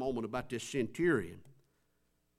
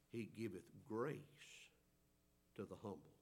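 soft hushed; 7 semitones lower, 14 dB quieter than usual. Acted. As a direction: let the promise fall softly. Hushed emphasis on verse.

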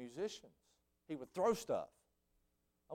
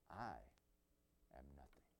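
near-whispered humility; 11 semitones lower, 19 dB quieter than usual. Retold or imitated. Conductor humbling himself before his musicians, near whisper. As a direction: let it fall to almost nothing. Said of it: "And he says".

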